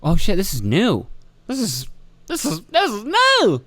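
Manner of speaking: mock surprise. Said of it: "and I was like".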